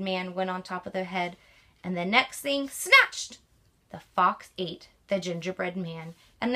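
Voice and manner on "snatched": sudden exclaim. A narrator's reading. Fox snatches the gingerbread man.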